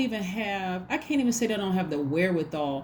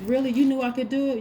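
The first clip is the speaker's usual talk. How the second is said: sceptical retort; 7 semitones higher, 3 dB louder, faster than usual. Acted. Acts sceptical reply to caller.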